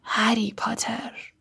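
slow, soft whisper; hushed voice. A narrator's reading. The face greets Harry Potter in a slow, hushed whisper. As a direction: breathe the name out slowly.

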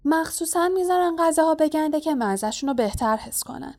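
high-pitched voiced girl character; nose-holding Hermione. A narrator's reading. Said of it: "هرمایونی بینیش را گرفت".

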